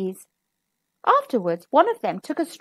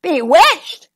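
loud incredulous roar; king roaring at the piper; 4 semitones higher, 8 dB louder than usual. Acted. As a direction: roar the word back in disbelief. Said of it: "roared the king".

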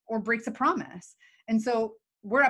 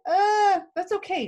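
mock teary; 10 semitones higher, 7 dB louder than usual. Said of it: "if the other person is like".

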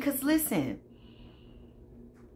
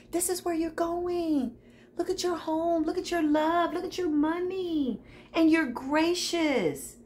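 tender gushing; 7 semitones higher than usual. Real, not acted. Gushes over the listener's good future.

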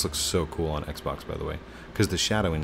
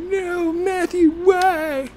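high, loud wailing "why"; overacted despair, voice shooting up; mock anguished cry; 9 dB louder, much slower than usual. Mock despair over empty base.

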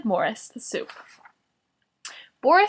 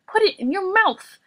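high, exasperated order; Boris; 10 semitones higher than usual. A narrator's reading. Boris telling Morris to take cough drop, shouting. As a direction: raise the pitch in impatient command. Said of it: "Boris shouted".

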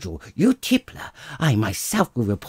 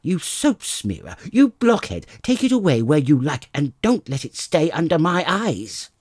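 loud, high furious tirade; furious wife; 4 semitones higher, 4 dB louder than usual. Acted. Wife driving her husband out with the nose, loud and furious. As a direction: hurl the insults at him.

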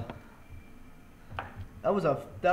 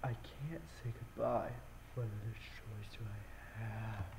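much softer, breathing into mic; breathy into mic; 5 semitones lower, 14 dB quieter than usual. Real, not acted. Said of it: "Hopefully you guys enjoyed that breathing".

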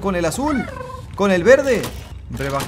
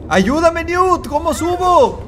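high, urgent call for help; 5 dB louder, slower than usual. Real, not acted. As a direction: cry out to a partner. Calling partner for help.

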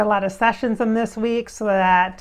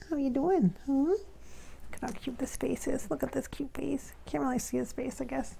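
much softer, cooing over the pet; 2 semitones higher, 12 dB quieter than usual. Real, not acted. To the small dog in her lap, soft cooing. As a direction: murmur fondly to the dog.

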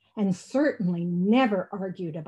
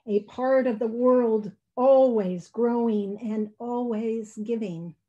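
ordinary delivery

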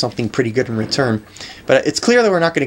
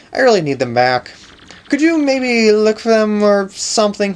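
put-on higher-pitched casual girl voice; girlish character; 8 semitones higher than usual. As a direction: ask a favour in a lifted, girlish voice. Voices a female game character.

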